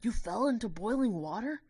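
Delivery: loud, high disbelieving question; shocked. Acted. Narrator shocked at the sylph's cooking mishap. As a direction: gasp it out in alarm. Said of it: "I was shocked".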